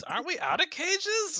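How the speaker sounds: high-pitched nervous underling; high lackey voice. Acted. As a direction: squeak it like a minion. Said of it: "another one says".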